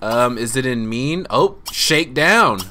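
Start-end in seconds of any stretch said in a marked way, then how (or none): none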